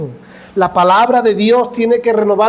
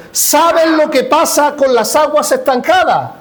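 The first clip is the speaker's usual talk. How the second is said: raised, driving sermon voice; fervent preaching; 5 semitones higher than usual. Acted. A pastor exhorts the church against stagnation, fervent preaching. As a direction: build it like a preacher's climax.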